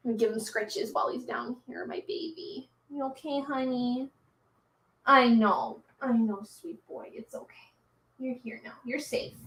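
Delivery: hushed, tender words to a scared dog; gently calm him down, barely above a whisper; soft soothing. Real, not acted. Owner soothing her frightened dog lying at her feet, soft and tender.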